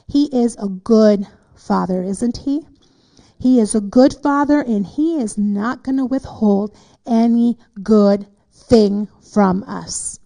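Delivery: loud, forceful preacher declaration; emphatic preaching. Real, not acted. Preacher assuring listeners of a good Father, emphatic preaching. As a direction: drive it home to the congregation, loudly.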